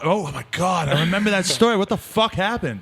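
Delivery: high-pitched, astonished outburst; astonished, high and animated; shocked recognition. Real, not acted. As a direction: blurt it out in amazed disbelief. Host suddenly recalls the shooting story.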